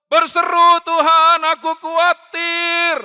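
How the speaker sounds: very loud, high sustained cry; crying out to God. Acted. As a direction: belt out the worried prayer, stretching the last word.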